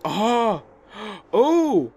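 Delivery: sudden startled "Oh!"; startled gasp. Real, not acted. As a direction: jump at the sight. Startled by another eye.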